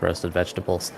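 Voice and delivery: normal talking voice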